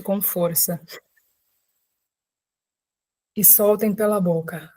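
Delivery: unhurried calming voice with long pauses; guided meditation. Real, not acted. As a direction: guide listeners gently into breath and body, leaving space after each phrase. Leads a guided breathing meditation.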